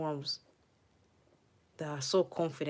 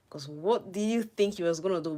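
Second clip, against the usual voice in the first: ordinary talk, nothing marked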